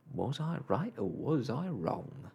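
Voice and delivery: taunting starling with swooping pitch; gloating bird's jeer. A narrator's reading. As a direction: crow in triumph, sing-song and mocking. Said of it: "he jeered".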